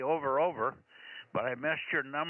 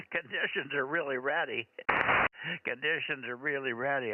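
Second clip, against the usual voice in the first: usual speaking voice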